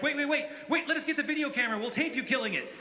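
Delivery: high, eager clamour; high-pitched, hurried excitement; excited boys. Retold or imitated. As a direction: imitate teenagers pleading to film it. Excited teenage boys urge him.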